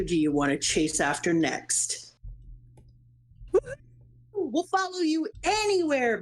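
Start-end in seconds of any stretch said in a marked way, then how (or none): 4.3-6.2 higher, eager crew voice; a loyal sidekick promising to follow; eager crewman. Acted. Crew member voiced in game dialogue.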